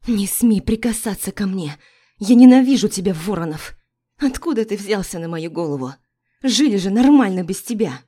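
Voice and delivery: loud angry outburst at him; wife shouting her hatred at her ex-husband; furious shout. Acted. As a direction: hurl it at him in rage.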